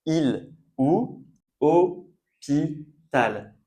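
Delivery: HÔPITAL very slowly as "o... pi... tal"; syllable by syllable. Shown as a demonstration. Teacher giving examples with accents, "hôpital" in slow syllables. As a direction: draw out each piece of "hôpital", pausing between. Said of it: "j'ai prononcé hôpital".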